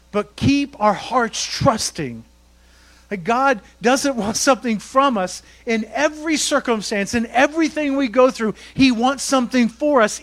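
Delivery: rising fervent sermon voice; full-force preaching. Real, not acted. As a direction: drive the promise home to the church.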